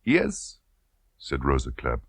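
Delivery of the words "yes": insinuating colonel. Acted. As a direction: lean in on the question. Klebb confirms.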